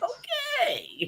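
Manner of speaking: high-pitched comic retort; mock squeaky reply. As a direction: squeak it out as a retold reaction. Teller's retold reply to desperate man.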